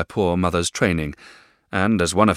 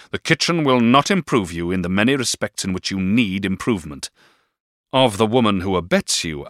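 louder, commanding and cold; stern stepfather command; 4 semitones higher, 6 dB louder than usual. A narrator's reading. Stepfather orders David to obey, commanding. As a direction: lay down the law. Mr Murdstone voiced.

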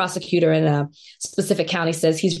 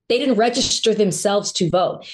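normal talking voice

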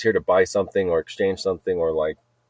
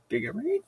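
high, softer squeak; comic questioning squeal; 13 semitones higher, 7 dB quieter than usual. Acted. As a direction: squeak a small baffled question. Repeats the comic squeal after laughing.

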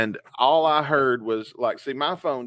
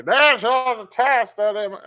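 much higher, loud announcer voice; emergency broadcast voice; 10 semitones higher, 7 dB louder than usual. Retold or imitated. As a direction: imitate the alert starting up yet again. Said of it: "and I hear".

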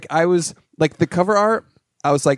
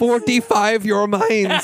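movie line quote; 6 semitones higher, slower than usual. Retold or imitated. Friends mimic a movie line.

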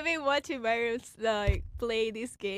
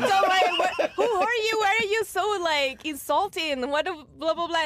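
put-on indignant girl voice; 4 semitones higher than usual. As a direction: play the offended girl, half laughing. Girl player quoted.

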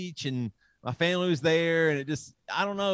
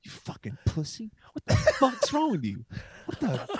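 raised-pitch ranting at himself; hammed-up self-scolding; mock angry inner voice; 7 semitones higher than usual. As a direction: berate himself like an inner bully. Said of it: "the other [name] started going".

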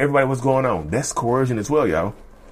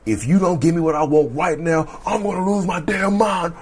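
a hint of a raging partner, somewhat higher; mock narcissistic rage; 5 semitones higher than usual. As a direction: threaten like you're about to snap, half-acted. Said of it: "Narcissistic rage can be coercive".

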